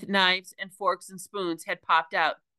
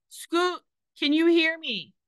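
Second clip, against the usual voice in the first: a high, urgent call once more; repeated shout; 10 semitones higher than usual. A narrator's reading. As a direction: call again, hoping she hears. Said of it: "Pounded again and repeated".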